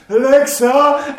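imitated little girl calling loudly; high, drawn-out child's call to a smart speaker; Emily. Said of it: "you hear Emily going".

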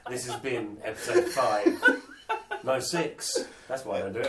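loud, giggly presenter voice; podcast host fumbling the outro; laughing host intro. Pretend. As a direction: launch a cheesy show intro while cracking up. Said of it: "do an intro too".

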